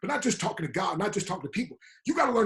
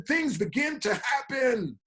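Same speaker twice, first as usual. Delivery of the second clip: drawn-out preaching; 5 semitones higher, much slower than usual. Acted. Preacher climax of quote.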